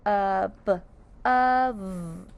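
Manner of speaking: "Uh, b, of" as separate sounds, the last held; sounded out. Shown as a demonstration. Teacher breaking above into its sounds, sounded out. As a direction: gaps between Uh, b and a long of. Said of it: "Let's sound out above".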